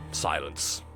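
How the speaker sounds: ordinary talk, nothing marked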